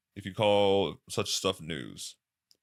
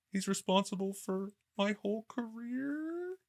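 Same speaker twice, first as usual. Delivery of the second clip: high, softer disbelieving question; mock tearful surprise, pitched way up; amazed, teary girl; 13 semitones higher, 7 dB quieter than usual. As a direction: falsetto awed whisper-like voice. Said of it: "she's like".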